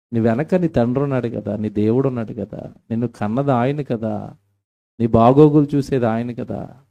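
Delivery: normal talking voice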